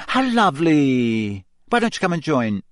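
lightly voiced sweet-talking journalist; mild beaming invite. A narrator's reading. Journalist inviting Harry to join, mildly syrupy.

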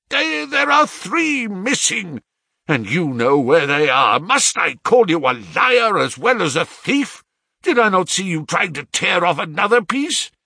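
high, heated interrogation; furious accusation. A narrator's reading. Father calls his son liar and thief, furious shouting. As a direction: hurl each question at him angrily.

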